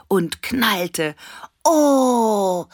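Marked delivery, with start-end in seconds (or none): 1.6-2.7 long, loud, high "oh"; awed "oh" cry. A narrator's reading. Annabella watching fireworks, long awed cry. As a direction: draw out a high cry of wonder. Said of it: "rief Annabella".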